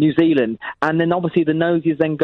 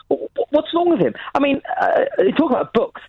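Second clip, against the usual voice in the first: outraged high rant; 9 semitones higher than usual. Acted.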